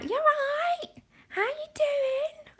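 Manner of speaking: high, drawn-out Cockney call; exaggerated Londoner's hello, pitched right up; Cockney greeting. Londoner mocking how she used to greet people, exaggerated Cockney. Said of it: "I used to be like".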